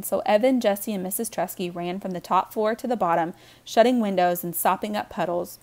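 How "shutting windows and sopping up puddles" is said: plain reading voice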